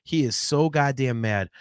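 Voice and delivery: ordinary talk, nothing marked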